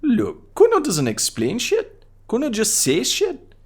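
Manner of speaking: put-on high-pitched mouthy kid voice; Kuno voice. Kid Kuno mocks the cop, high bratty kid voice. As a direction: voice a rude little brat up high, talking about himself in third person. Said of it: "What is wrong with your high voice?".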